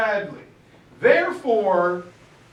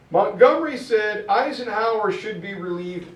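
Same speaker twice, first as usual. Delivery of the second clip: usual speaking voice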